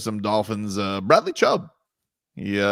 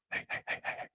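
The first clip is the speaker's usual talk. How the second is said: quiet repeated hey; soft calling; 18 dB quieter, faster than usual. Retold or imitated. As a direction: call softly for attention. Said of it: "He goes".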